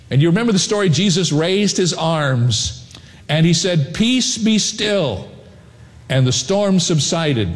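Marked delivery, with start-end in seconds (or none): 3.9-5.3 slow, higher, hushed calm; calm command. Acted. Jesus speaking to the storm, slow and calming.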